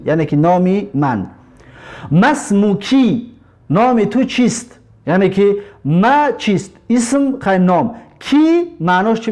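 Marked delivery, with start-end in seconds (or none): 2.1-3.3 مسموکی drawn out slowly, each syllable clear; teacher spells out مسموکی slowly for students; slow Arabic demo. Shown as a demonstration. Teacher models the word for students, slow and clear. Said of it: "معناش که چی هست".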